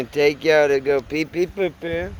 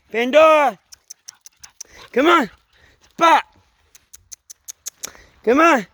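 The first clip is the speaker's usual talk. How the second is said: loud high commands to dogs; calling dogs loudly; 7 semitones higher, 8 dB louder than usual. Real, not acted. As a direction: call the dogs back in, loud.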